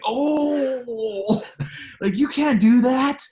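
high, drawn-out mock outcry; scandalized crowd, played up high and loud; imitated group objection. Coworkers objecting to her thick accent, high mock outrage. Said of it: "and everybody's like".

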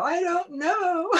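high, slow, teary half-laughing whimper; laughing through mock tears; teary I don't know. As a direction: whimper it like a lost, tearful new mom. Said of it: "And I go".

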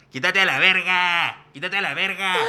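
mock angry yell at an opponent, slow first shout. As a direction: bark it like a hothead kid on the field. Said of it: "empieza a gritar".

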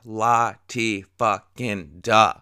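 very slow, mocking la-ti-da; syllables stretched in mock boredom; sarcastic dismissal. Dismissing the origin story.

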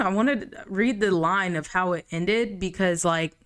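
usual speaking voice

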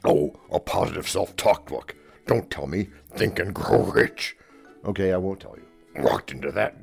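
normal talking voice

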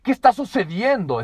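very high, loud furious call; loud angry challenge from the belly; angry demand. Shown as a demonstration. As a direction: confront them sharply. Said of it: "Es, es enojo o es poder".

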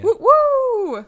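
high, loud whoop of celebration; excited cheer. Real, not acted. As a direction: cheer it out big. Cheering end of segment.

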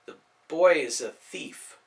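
slow, grudging reply; slow answer. Acted. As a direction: draw each word out reluctantly. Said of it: "Slowly he answered".

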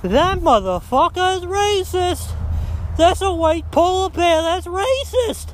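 high-pitched, drawn-out silly indignation; mock outraged accuser. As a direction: imitate someone absurdly offended, voice pushed way up. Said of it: "and goes, You know what?".

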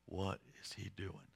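very low, soft wondering; barely audible onlooker's question; hushed suspense. Acted. Everybody watching Jesus at the open tomb, hushed and low.

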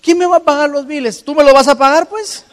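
mock indignant protest; tired fisherman. Pedro to Jesus, mock protest over bills. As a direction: complain about the bills, high and loud. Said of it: "le dice".

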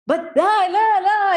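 high, loud mock gibberish of an angry husband. Mocking her hubby raising his voice, high and loud. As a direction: parody him ranting as nonsense. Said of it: "you're like raising his".

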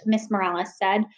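usual speaking voice

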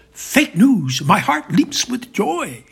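high, loud burst of glee; exultante. Acted. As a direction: gush loudly and high with delight. Putin gushing with delight at Trump.

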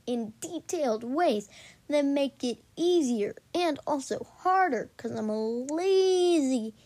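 slow, loud grating drawl; annoying voice. Pretend. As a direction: stretch every word to annoy. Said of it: "I'm gonna stop talking in this annoying voice".

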